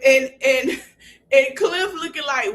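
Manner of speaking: normal talking voice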